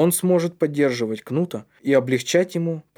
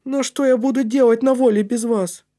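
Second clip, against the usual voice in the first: put-on high, loud, pleading child voice; distressed boy; 11 semitones higher, 5 dB louder than usual. A narrator's reading. Матиас won't go free without his friends, upset.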